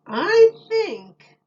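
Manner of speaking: put-on a high little boy. A narrator's reading. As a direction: pipe up like a small boy. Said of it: "said the little boy".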